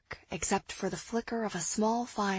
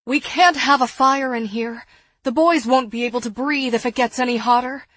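loud, agitated objection; frantic protest; 3 semitones higher, 10 dB louder than usual. A narrator's reading. Woman fearing boys can't breathe, loud and agitated. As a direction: protest loudly, rushing toward the end.

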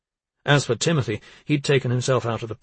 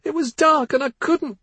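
high, tearful cry, much higher; 5 dB louder than usual. Acted. Teenage girl answers her furious father, crying. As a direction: sob the words out. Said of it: "Missa cried".

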